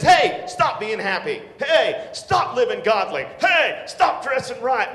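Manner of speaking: loud, slow mock scolding; mock rebuke. Imagined rebuke of a godly youth, loud and mocking. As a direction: scold in a hammed-up way.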